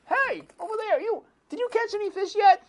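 high shouted call to the other boat; high squeaky fisherman hollering over. Acted. Fisherman calling across the water.

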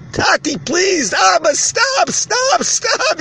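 high-pitched desperate pleading; yelling, crying child. Retold or imitated. Child begging father to stop, yelling and crying. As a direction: plead like a child in pain, voice shooting up. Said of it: "The child is yelling and crying".

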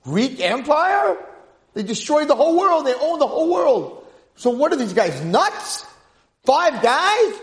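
high, loud disbelief; astonished, forceful outcry; incredulous shouting. Acted. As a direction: exclaim it as if it's utter madness. Heated disbelief at five against empire.

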